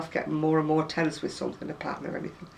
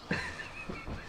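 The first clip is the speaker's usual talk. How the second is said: breathy giggle; 12 dB quieter than usual. Real, not acted. Laughing at barking dog.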